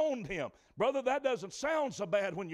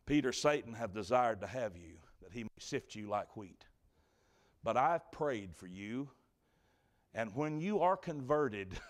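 gentle voice of Jesus; 9 semitones lower than usual. Retold or imitated. Preacher voicing Jesus to Peter, low and tender. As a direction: let the Lord speak low and kind.

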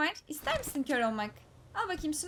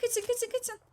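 panicked; 10 semitones higher, slower than usual. Real, not acted. Enemies rushing him, panic.

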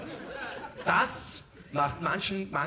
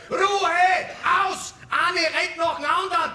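shout; 7 semitones higher than usual. Acted. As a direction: bark for silence. Silencing a group of women.

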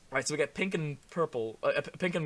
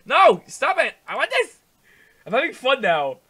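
insistent protest; 9 semitones higher, 17 dB louder than usual. Real, not acted. Arguing with the game.

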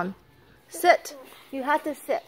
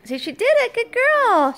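much higher and louder, delighted praise; high dog praise; 13 semitones higher, 6 dB louder than usual. Real, not acted. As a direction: reward the dog with a bright high voice.

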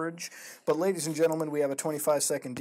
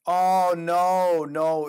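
loud, higher cry of dismay; 3 semitones higher, 8 dB louder, much slower than usual. Real, not acted. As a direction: cry out in disappointment. Finds the story was never finished.

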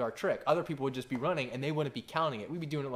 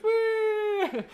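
put-on squeaky little boy squeal; 8 dB louder than usual. Son's reaction after being called liar.

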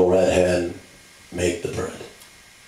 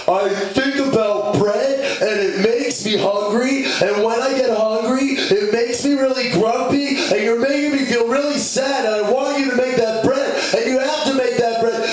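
put-on very high, squealing kid meltdown; high-pitched hammed-up run-on tantrum; hungry piggy tantrum. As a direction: whine relentlessly in a piglet squeal.

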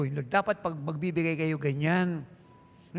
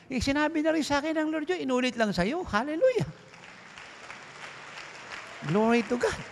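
exultant preaching; 8 semitones higher than usual. Real, not acted. Preacher rising into exultant praise.

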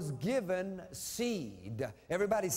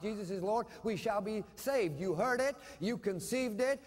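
ordinary talk

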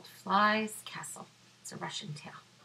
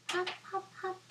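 bouncy hop sounds; 12 semitones higher, 5 dB quieter, faster than usual. Acted. Bunny hopping.

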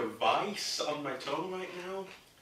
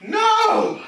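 high, loud panicked refusal; shouted no; 14 dB louder than usual. Real, not acted. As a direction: yell it in protest. Refusing to eat more.

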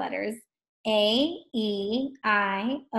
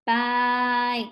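BIKE on one long level vowel; said slowly; 3 dB louder than usual. Shown as a demonstration. Teacher asking what they hear, BIKE said slowly. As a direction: draw out the I in BIKE. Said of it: "Say it slow".

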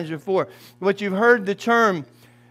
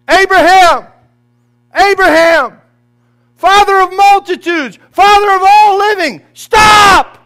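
high, loud heavenly cry to stop; pleading shout from the sky; angels shouting; 10 semitones higher, 12 dB louder than usual. Acted. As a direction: call out like angels from heaven in alarm. Angels crying out to stop Abraham.

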